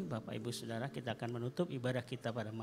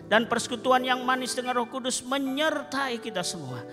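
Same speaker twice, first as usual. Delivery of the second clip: proclaimed blessing; 6 semitones higher, 6 dB louder than usual. Real, not acted. Benediction proclaimed.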